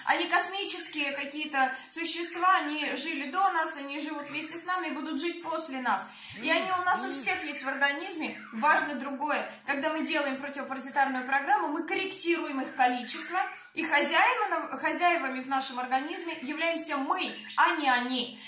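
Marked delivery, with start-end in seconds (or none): none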